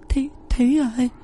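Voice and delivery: high, stuttering terrified cry; stammering in terror. A narrator's reading. Old cook sees hanging corpse, stammers in high terror. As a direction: stutter out the warning in panic. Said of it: "Bà bếp lắp bắp".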